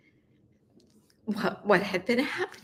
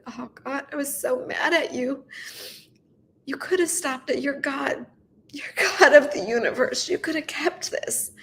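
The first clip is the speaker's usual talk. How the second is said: high, pleading complaint to God; crying, hurt and accusing; tearful reproach; 5 semitones higher than usual. Real, not acted. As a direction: protest through tears, voice lifted.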